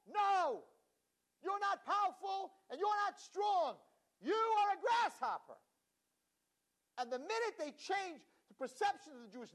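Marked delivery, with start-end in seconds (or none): 0.1-5.6 high, loud crushing shout; shouting spies. Acted. Spies crushing the nation's courage, loud shout. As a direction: shout them down.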